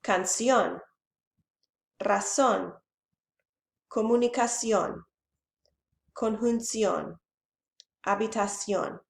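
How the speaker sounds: "Canción", "razón", "comunicación", "conjunción", "habitación", each stressed on the last syllable; final-syllable stress. Shown as a demonstration. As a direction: put the weight on the final "ón" of "Canción" through "habitación". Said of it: "stress is on the last syllable".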